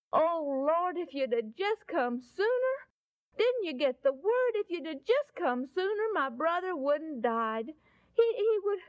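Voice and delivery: acted-out reproachful lament; distressed pleading. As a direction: plead through grief, pitch lifted. Grieving Martha pleads with Jesus.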